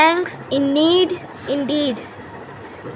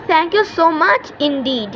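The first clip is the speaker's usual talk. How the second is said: slow drill; much slower than usual. Shown as a demonstration. Teacher drills phrase slowly.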